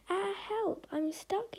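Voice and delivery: high, panicked call for help; a high yell for help. A narrator's reading. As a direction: cry out, stuck and scared. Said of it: "yelled Parker".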